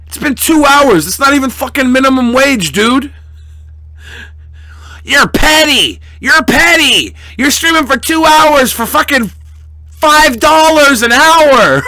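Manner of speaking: high, loud mock outrage; over-the-top indignant yelling; mock rant. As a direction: rant in hammed-up disbelief. Mock-ranting at petty donor.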